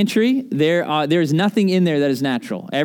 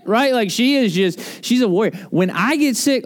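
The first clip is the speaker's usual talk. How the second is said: ordinary delivery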